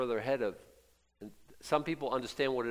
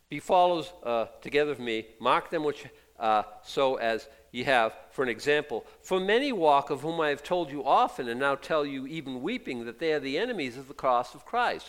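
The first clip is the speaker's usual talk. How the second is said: raised, declaiming Bible reading; scripture reading; 3 semitones higher, 5 dB louder than usual. Acted. Pastor reading Paul's letter aloud, declaiming. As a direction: proclaim the verses to the congregation.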